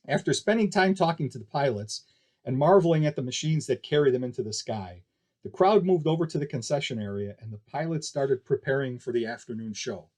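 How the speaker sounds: ordinary delivery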